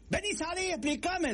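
husband calling again for his wife, high and pleading; mock yelling. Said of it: "decía el negro".

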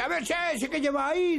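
imitar una voz aguda que interpela; voz misteriosa imitada. Retold or imitated. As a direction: llamada aguda, recontada a los amigos. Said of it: "una voz que me dice". (jorobado imitando la voz del cementerio)

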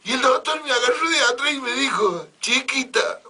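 high, loud mock sobbing maid; comic high-pitched blubbering; hammed-up wailing. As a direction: wail it like a hysterical maid. Maid recounting doctor's advance.

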